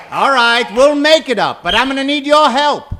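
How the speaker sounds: ordinary talk, nothing marked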